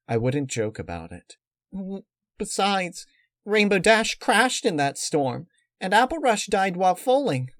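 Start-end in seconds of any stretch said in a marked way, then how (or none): 1.7-7.5 much higher, louder cheerful pony voice; high-pitched cartoon pony girl; Pinkie Pie voice. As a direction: play the pink pony, pitched way up.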